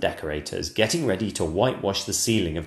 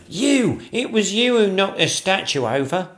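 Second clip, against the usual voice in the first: high, loud accusation; a panicky servant throwing the blame back; gasping, accusing footman; 11 semitones higher, 5 dB louder than usual. Acted. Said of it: "gasped the footman".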